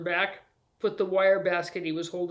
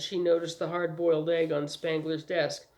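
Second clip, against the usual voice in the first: plain reading voice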